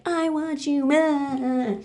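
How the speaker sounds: slow, high, drawn-out mock plea; mock pleading girlfriend. Girlfriend from Spain shows up, slow high mock pleading. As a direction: stretch out a hammed-up, high plea. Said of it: "shows up and is like".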